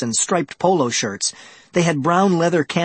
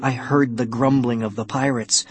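ordinary delivery, nothing marked

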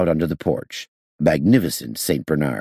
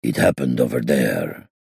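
low muffled murmur, slightly distant; 5 semitones lower than usual. A narrator's reading. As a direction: keep it low and smothered. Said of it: "in an unnaturally low and muffled voice".

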